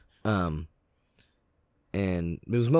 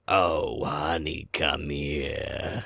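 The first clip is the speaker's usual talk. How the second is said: brother voice; 4 semitones lower, much slower than usual. Retold or imitated. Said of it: "He goes".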